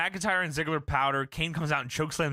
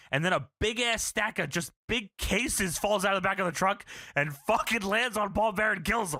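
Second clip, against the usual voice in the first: ordinary delivery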